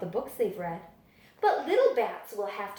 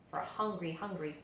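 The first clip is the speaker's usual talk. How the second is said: much softer, lower and tender; tender whisper-soft; 6 semitones lower, 10 dB quieter, faster than usual. A narrator's reading. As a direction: say it lovingly, hushed. Dad's tender lunch note.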